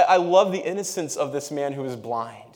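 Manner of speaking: normal talking voice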